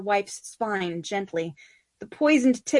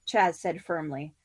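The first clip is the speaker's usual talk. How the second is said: usual speaking voice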